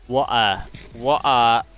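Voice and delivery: "Water" as "wo'ah", exaggerated British T-drop; mock British accent. Shown as a demonstration. Showing how Americans imagine Brits say water, mock British accent. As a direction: caricature the British way of saying "Water". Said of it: "that's how Americans think, uh, British people pronounce water".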